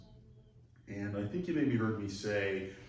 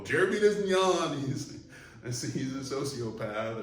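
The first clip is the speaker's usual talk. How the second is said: much higher and louder, hammed-up alarm; mock accusation; 5 semitones higher, 7 dB louder than usual. Onlooker pointing out that Jeremy never yawns, in mock alarm. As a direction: play the nosy accuser for laughs.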